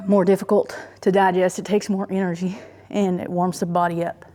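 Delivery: ordinary delivery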